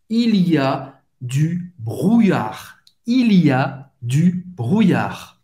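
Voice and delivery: slow, separated French words; careful teacher's repeat, word by word; slow model pronunciation. Shown as a demonstration. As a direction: spell it out by ear for learners. Teacher models new expression slowly.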